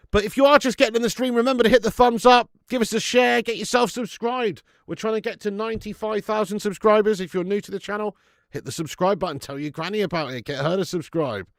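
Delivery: rally the viewers like a presenter; upbeat stream plug; channel promo pitch. Acted.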